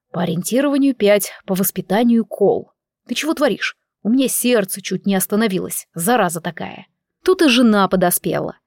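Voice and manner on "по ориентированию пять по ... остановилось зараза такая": usual speaking voice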